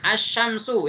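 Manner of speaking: "asyamsu" with a held sh and no L; silent alif lam. Shown as a demonstration. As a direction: drop the L, lean on sh in "asyamsu". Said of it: "Tapi alif lamnya nggak ke".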